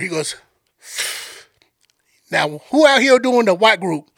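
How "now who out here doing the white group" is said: put-on drawling Cajun tour guide accent; Sambo's Cajun accent. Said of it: "I'm gonna play this dude's accent".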